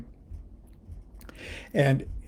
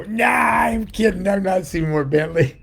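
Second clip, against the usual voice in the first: gleeful shout; 7 semitones higher than usual. Retold or imitated. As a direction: yell out the punchline to the crowd. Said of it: "I'd go".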